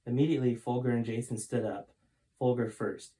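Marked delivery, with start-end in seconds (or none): none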